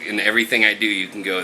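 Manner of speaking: normal talking voice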